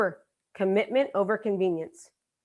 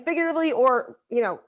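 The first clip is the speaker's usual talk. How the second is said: usual speaking voice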